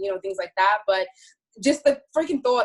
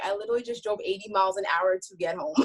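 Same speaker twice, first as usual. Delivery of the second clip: ordinary talk, nothing marked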